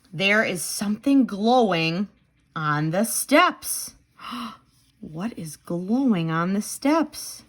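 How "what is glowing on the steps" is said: ordinary delivery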